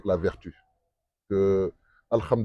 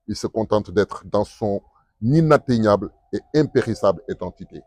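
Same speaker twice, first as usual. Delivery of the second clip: poem recital; 4 dB louder than usual. Shown as a demonstration. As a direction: recite with rising passion. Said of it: "je vais le déclamer juste une partie".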